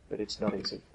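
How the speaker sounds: a bare whisper, almost no voice; whispered admission. Acted. Jesus to the Father, whispering that obedience is not easy. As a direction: let the words fall away into a whisper.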